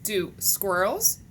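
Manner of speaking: ordinary talk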